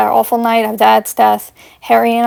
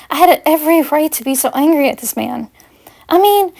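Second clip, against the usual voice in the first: ordinary delivery, nothing marked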